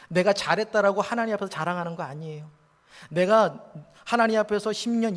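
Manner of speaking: ordinary delivery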